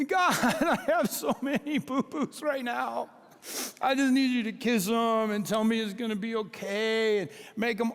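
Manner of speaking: high, laughing mock whine to God; whiny child. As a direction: play a pouting kid begging for kisses. Mocking a whiny believer to God.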